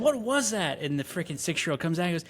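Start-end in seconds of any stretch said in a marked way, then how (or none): 0.0-0.8 alarmed mom. Retold or imitated. Mother running up the stairs.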